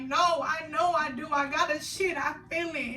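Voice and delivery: high, loud insistent whine; retold panicked crying. Daughter in labor insisting to her mother, panicked. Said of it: "And I'm like".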